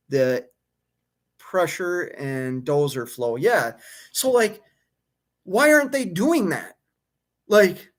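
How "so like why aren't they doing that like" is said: much higher, incredulous question; can't believe they skipped it; exasperated. Real, not acted. Exasperated rant about mechanics.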